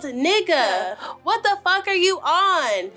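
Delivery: high heated yelling rant; high furious tirade; angry yell. Retold or imitated. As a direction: go off on the driver, voice up high. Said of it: "turned to him and yelled".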